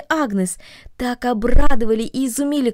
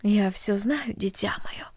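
hushed, tender reassurance; soft mother; 6 dB quieter, slower than usual. A narrator's reading. Mother quietly comforts the scared girl. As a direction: soothe a frightened child gently. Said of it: "тихо сказала мать".

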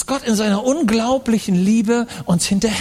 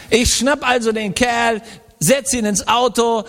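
normal talking voice

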